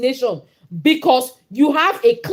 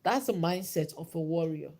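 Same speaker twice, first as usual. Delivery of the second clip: quiet; 5 semitones lower, 9 dB quieter than usual. Real, not acted. Repeated line, dropped low.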